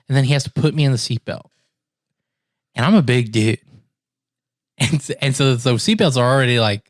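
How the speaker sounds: ordinary talk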